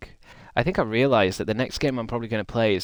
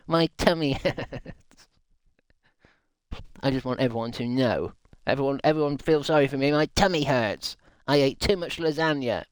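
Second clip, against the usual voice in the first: higher, put-on whimper with laughs; whiny sick kid; 4 semitones higher than usual. Streamer playing the chatter with a tummy ache, whiny. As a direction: beg for sympathy like a sulky child.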